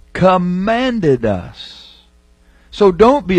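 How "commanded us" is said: "commanded" drawn out very slowly and falling; stress on commanded. Shown as a demonstration. Preacher to fathers, "commanded" stretched out slowly. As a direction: lean on each syllable of "commanded". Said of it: "watch it".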